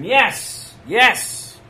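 mock crowd shouting yes; crowd yelling yes. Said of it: "they scream".

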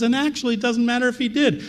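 high, dismissive tempter; mock serpent voice. Preacher voicing Satan dismissing God's command. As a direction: brush God's words aside slyly. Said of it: "And then he says".

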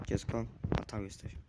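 ordinary delivery

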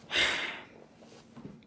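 long unvoiced sigh, trailing away; heavy sigh. Real, not acted. Alone and sad at night, sighing heavily. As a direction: let out a heavy breath.

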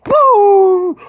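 huge high whooping yell; wild cheer. Real, not acted. Fan cheering the Leafs win, full throat whoop. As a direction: let out a big fan's whoop.

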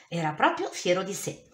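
usual speaking voice

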